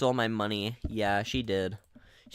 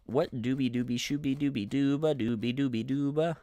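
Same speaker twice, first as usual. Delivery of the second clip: normal talking voice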